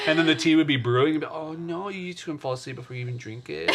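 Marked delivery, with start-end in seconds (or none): 1.3-3.7 put-on soft, cooing baby talk. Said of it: "talk in baby voice".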